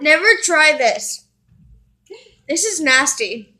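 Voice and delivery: loud disgusted groan. Real, not acted. Tasting gross melted cereal.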